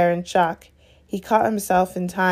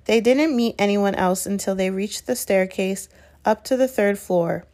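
ordinary delivery, nothing marked

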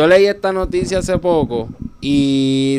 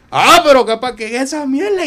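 startled outburst; 9 semitones higher, 5 dB louder than usual. Retold or imitated. Hit by a surprise missile.

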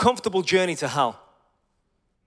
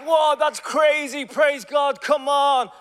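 slow, loud, high amazed shout; 7 semitones higher, 4 dB louder, much slower than usual. Retold or imitated. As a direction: shout your amazement and praise. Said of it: "So we were like".

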